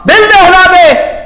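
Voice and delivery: very slow, high, loud cry; heart-shaking cry. Retold or imitated. Preacher shows the beggar's heart-shaking cry, slow and loud. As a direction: stretch the cry out to shake the heart. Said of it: "فقیر کی صدا".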